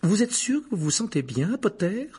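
high, clipped-off professorial question; dry. A narrator's reading. Professor checking on her pupil, dry. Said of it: "demanda sèchement le professeur McGonagall".

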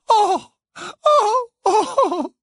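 very high, loud choking wails; choked sobs. A narrator's reading. Missus Wesley, face in hands, choked sobbing. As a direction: choke out each cry through a flood of tears. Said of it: "s'étrangla Missus Wesley".

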